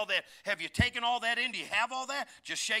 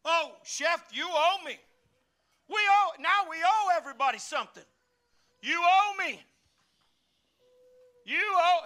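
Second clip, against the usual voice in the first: high, loud whiny claiming; mock entitled demand; 6 semitones higher, 6 dB louder than usual. Preacher mocking entitled people who say everyone owes them, loud and high. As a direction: point at everyone and demand your due.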